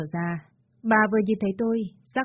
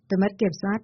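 ordinary delivery, nothing marked

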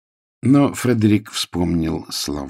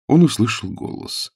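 plain reading voice

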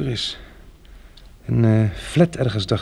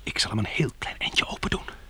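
whisper; 2 semitones lower, 8 dB quieter than usual. Acted. Opening the door quietly.

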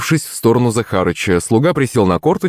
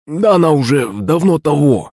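excited; 7 semitones higher than usual. A narrator's reading. Servant blurts denial.

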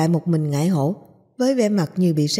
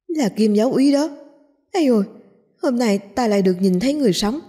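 higher-pitched, thrilled exclamation; awed excitement, pitched up; wildly excited; 4 semitones higher than usual. A narrator's reading. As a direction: gush as if you can't contain yourself. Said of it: "kích động tới nỗi không thể tự kiềm chế".

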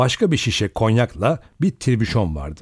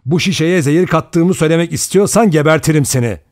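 heated threat, louder and higher; angry threat; 6 semitones higher, 5 dB louder than usual. A narrator's reading. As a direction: lash out with a raised voice. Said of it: "öfkeyle yerinden fırladı". (öfkeyle tehdit ederek)

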